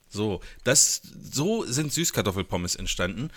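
ordinary delivery

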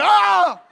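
very high, loud laughing shriek; high, loud fright shriek; startled scream. Retold or imitated. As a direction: shriek as if scared from behind. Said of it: "he's gonna".

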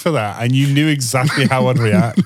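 breaking into laughter; can barely get words out for laughing; laughing while talking. Real, not acted. Reacting to shocking confession.

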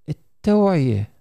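ordinary talk

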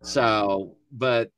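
ordinary talk

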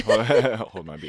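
drawn-out, laughing echo; slow laughing repeat. Shown as a demonstration. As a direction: stretch it out through a laugh. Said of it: "repeats slow, laughing".

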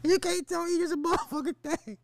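high, squeezed-out laughing repeat. Real, not acted. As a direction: say it through helpless laughter, high and strained. Repeats joke while breaking up laughing.

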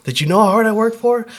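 pissed at God. Retold or imitated. Cursing God from the hospital bed, indignant. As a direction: rail at God for betrayal. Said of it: "I was so pissed at God".

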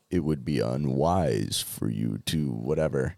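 low, calm Vader impression; deep menacing villain. Quoting Vader.

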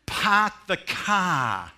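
loud, slow, drawn-out park the car with dropped r's; Boston accent. Retold or imitated. Preacher mocks Boston speech, loud slow Boston accent.

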